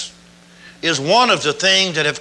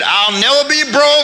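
higher and louder, with conviction; said like you mean it; 6 semitones higher, 3 dB louder than usual. Shown as a demonstration. Preacher showing the congregation how to mean it. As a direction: proclaim it as a firm vow. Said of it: "Now you gotta say it like you mean it".

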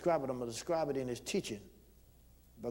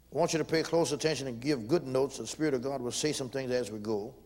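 normal talking voice

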